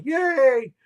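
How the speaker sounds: a high, loud cheer for the hero; cheering yay. Acted. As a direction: throw a big yay for Mordecai. Cheering cue after Mordecai's name.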